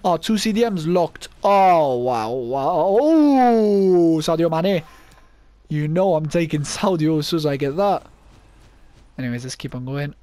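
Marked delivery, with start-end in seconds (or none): 1.4-4.8 loud, drawn-out wows; amazed outburst. Real, not acted. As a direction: blown away by a lucky pick, loudly. Reacting to Sadio Mane draft pick.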